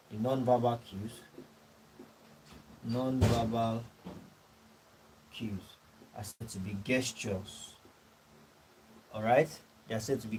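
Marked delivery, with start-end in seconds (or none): 2.9-7.8 softer, slow, long gaps between words; dictating slowly. Real, not acted. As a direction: let them copy each word down. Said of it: "dictating notes to the class".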